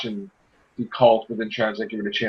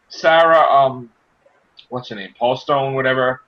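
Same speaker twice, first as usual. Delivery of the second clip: usual speaking voice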